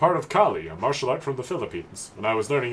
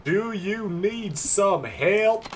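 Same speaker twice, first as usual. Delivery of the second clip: put-on high, very slow Japanese-accented English; terrible Japanese accent; 9 semitones higher, much slower than usual. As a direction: drag out each word in a bad Japanese accent. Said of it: "in, like, a terrible, terrible accent".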